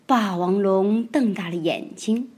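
usual speaking voice

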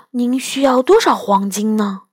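plain reading voice

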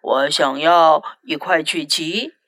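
boy in disguise puts on a low man's voice; low deep voice. 二飞假扮检查员，用低沉的嗓音说话. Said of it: "用一种低沉的嗓音大声说".

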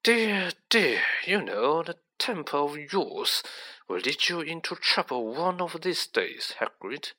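silky, patronising official; suave menacing villain. Acted. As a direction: chide smoothly with veiled threat.

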